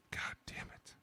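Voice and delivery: whispered curse under the breath; hushed retold curse. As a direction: whisper it, retelling your own reaction. Muttered reaction in retold call.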